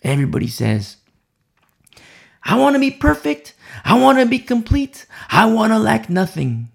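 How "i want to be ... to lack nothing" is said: higher, quicker mock-eager chant; eager wishing. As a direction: imitate everyone piping up with big wishes. Voices what everybody eagerly says.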